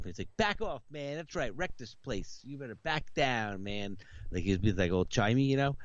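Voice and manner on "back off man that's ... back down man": higher, softer sidekick parroting the threat; a hint of a hanger-on echoing his buddy. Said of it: "he keeps repeating what he's saying".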